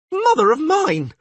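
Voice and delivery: high, loud cry of alarm; yelled. Acted. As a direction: shout it out in terror. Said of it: "yelled Dandilion".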